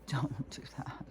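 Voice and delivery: hushed, breathy don't do that; soft whispered caution to the mom; whispered warning. Retold or imitated. Retelling her advice in comments.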